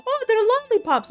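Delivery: very high, slow, sweet grandma voice; a delighted grandma who hasn't noticed yet; naive grandma. Retold or imitated. Grandma guessing what the candy is, very high and delighted.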